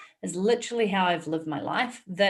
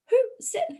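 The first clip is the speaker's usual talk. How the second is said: startled, much higher squeak; shocked. Retold or imitated. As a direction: jump at an unseen voice. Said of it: "I was so shocked and taken aback".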